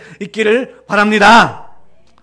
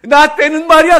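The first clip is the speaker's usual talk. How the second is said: put-on loud old-timer boast; 5 semitones higher, 10 dB louder than usual. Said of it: "그러는 거예요".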